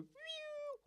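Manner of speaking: high whistling whoosh; quick, high-pitched "phew"; whistling missile sound. Retold or imitated. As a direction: whistle it like something flying through the air. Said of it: "it will go".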